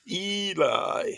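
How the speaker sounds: "Eli" drawn out as EE-lie, high; careful name pronunciation. Shown as a demonstration. Streamer practising a viewer's name, careful drawn-out pronunciation. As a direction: stretch the EE high in "Eli", then fall. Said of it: "it's the way he's saying it".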